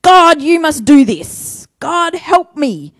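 demanding prayer. Retold or imitated. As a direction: order God around. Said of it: "prayers that say".

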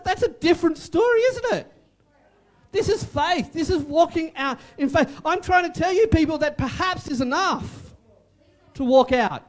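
normal talking voice

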